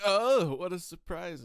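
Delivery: high, exaggerated "oh" of fake astonishment; sarcastically surprised retort; mock surprise. Retold sarcastic reply to the American.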